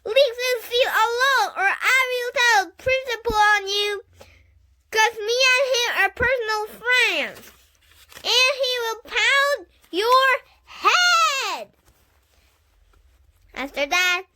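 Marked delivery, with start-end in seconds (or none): 0.0-11.7 put-on high, loud childish threat; threatening yell. A narrator's reading. Junie B threatening the boy with the principal, loud high yell. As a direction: threaten him with the principal, full volume.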